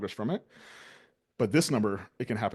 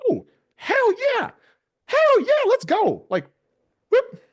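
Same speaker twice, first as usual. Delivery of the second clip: much higher, louder hyped yell of hell yeah; ecstatic shout; 12 dB louder, much slower than usual. Acted. The last stage of getting told yes, wild cheering shout. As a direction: scream it like you just won. Acting out final stage, full hype.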